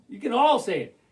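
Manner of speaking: ordinary delivery, nothing marked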